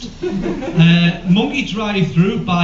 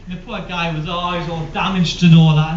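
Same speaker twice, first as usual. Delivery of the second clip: ordinary delivery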